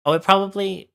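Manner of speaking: sudden high, loud realization; surprised. Real, not acted. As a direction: blurt it out as it dawns on you. Sudden realization about notifications.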